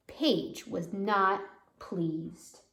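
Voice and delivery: ordinary delivery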